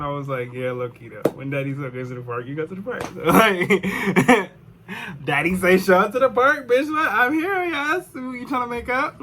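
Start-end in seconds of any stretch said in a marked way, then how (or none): 0.5-3.0 laughing along. Retold or imitated. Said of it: "And then I started laughing". 3.0-5.8 laughing through the words; chuckling as the joke lands. Real, not acted. 5.8-9.2 high mocking squeal of a cocky guy; mock daddy voice.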